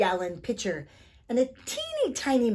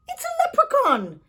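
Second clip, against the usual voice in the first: excited; 8 dB louder than usual. Acted. Tom's excited discovery.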